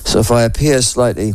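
usual speaking voice

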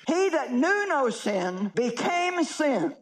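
weighty, slow reading of the verse; slow emphatic scripture reading. Shown as a demonstration. Preacher reading the verse to the crowd, slow and weighty. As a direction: let each word land. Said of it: "reading the verse slowly".

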